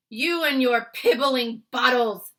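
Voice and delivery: loud slow scornful bellow; shouting. Acted. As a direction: sneer it at the top of your voice. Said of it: "he shouted".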